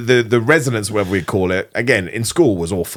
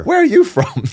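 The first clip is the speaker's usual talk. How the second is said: mock amazement; 3 dB louder than usual. Acting amazement at Oldman's accent.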